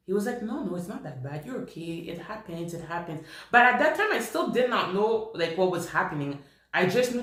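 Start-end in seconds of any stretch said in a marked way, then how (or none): 0.4-3.3 quietly voiced friend soothing her; soft reassurance. Friend reassures her after the episode.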